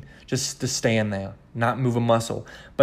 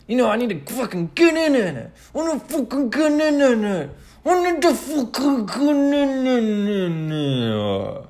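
high, stuttering robot breakdown; dying robot; 10 semitones higher, slower than usual. Pretend. Actor saying he must get in character, dying robot voice. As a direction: malfunction and wind down like a dying robot. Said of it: "I was a dying robot".

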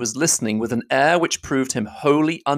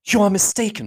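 put-on higher young woman's voice, accusing; a higher heroine's voice pressing her charge; Elizabeth, indignant; 10 semitones higher, 3 dB louder than usual. Elizabeth confronting Darcy, higher and accusing.

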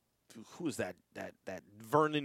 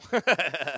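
louder, higher burst of laughter; laughing; 5 semitones higher, 5 dB louder than usual. Real, not acted. Host hearing the botched Aragon question, bursting into laughter.

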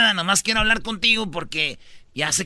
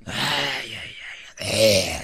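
very low, growling lion villain; drawn-out villainous lion rumble; Scar impression; 9 semitones lower, 4 dB quieter, much slower than usual. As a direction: menace from deep down, slow and growly. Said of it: "era como Scarf".

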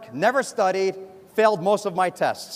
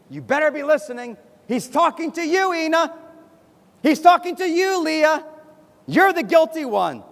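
high, pointed mock finger-wagging; accusing; 6 semitones higher than usual. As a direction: act the self-righteous churchgoer singling people out. Churchgoer accuses others during sermon.

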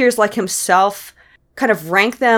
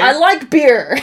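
frat bro; 5 semitones higher, 4 dB louder, slower than usual. Acted. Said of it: "sound like a frat boy".

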